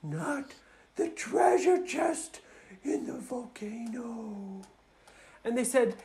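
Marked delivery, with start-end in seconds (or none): 0.0-4.6 put-on refusing girl dragon. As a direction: start insistent and high, sink to a guarded hush. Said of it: "And she said".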